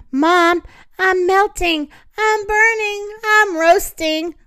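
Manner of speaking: high, rising wail; distressed complaint. A narrator's reading. Victor overheats, complains to Mom.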